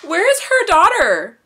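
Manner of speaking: loud, high alarmed wail; distressed outcry. Real, not acted. Viewer asking after the missing daughter, loud high distressed cry. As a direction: cry it out in dismay.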